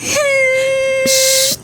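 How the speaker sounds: long hushing "shh"; soothing shush. Acted. Mother hushes her crying daughter, soothing shush. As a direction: hush a crying child.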